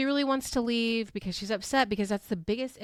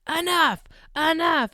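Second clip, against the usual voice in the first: loud, higher-pitched mock command; imitated shout; 7 semitones higher, 6 dB louder than usual. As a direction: shout it as if breaking up a fight. Acting out housemate breaking up fight.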